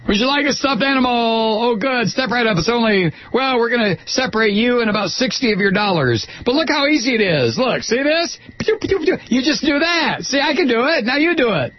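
high, fast hustling barker pitch. Retold or imitated. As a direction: lure the rubes in, fast and high. Imitating carnival barker.

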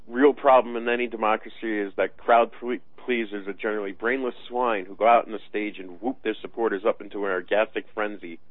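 ordinary talk

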